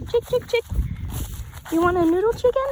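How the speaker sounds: high, loud chicken call. Real, not acted. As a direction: call the hens over sweetly. Calling chickens to eat.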